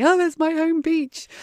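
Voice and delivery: high, loud delighted cry of recognition; excited exclamation. Retold or imitated. Re-enacts spotting hometown on TV.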